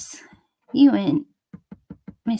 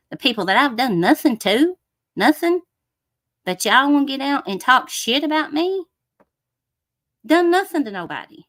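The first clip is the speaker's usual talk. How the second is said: rising louder and higher, furious; angry outburst; 4 semitones higher, 4 dB louder than usual. Real, not acted. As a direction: vent the grievance, building anger. Angry rant about being slandered.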